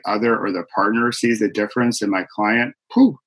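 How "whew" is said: sudden high whoosh of breath; emphatic whew. Real, not acted. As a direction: blow out an awed whew. Moved by partners noticing change.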